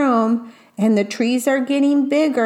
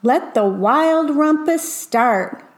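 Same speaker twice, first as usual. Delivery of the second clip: slow, higher grand proclamation; slow and higher, savouring each word; crying out; 4 semitones higher than usual. Acted. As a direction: announce the rumpus like a little king. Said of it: "cried Max".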